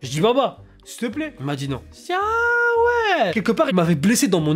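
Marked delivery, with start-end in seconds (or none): 0.2-1.3 small high pleading please; pleading child. Retold or imitated. 2.1-3.3 high, long-drawn "ah ouais"; slow high-pitched offended drawl; stung reaction. Retold or imitated. Mock-offended reaction to refusal.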